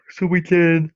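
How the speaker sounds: talking through a yawn; words stretched by a real yawn. Real, not acted. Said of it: "I'm yawning".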